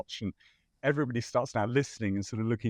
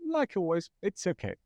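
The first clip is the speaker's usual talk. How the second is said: imitating her higher-pitched resignation; flustered but accepting; 7 semitones higher than usual. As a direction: act out putting up with it, pitched up.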